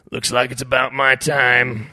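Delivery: slow, loud, gravel-tinged creature voice; gravelly large shrimp. A narrator's reading. Doomed shrimp tells Ed its turn has come, gravelly. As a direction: drag it out with resigned gravel. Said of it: "The voice was deeper ... hint of gravel".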